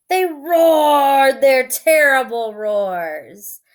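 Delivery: story reading. A narrator's reading. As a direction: read it like a bedtime story. Reads picture book aloud.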